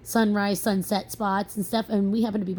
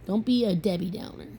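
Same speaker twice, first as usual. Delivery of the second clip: ordinary talk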